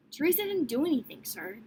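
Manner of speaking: ordinary delivery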